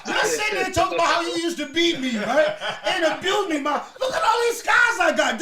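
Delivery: loud, high teasing through laughter; laughing shout. Real, not acted. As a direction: roast your mom while laughing. Teasing mom loudly, laughing.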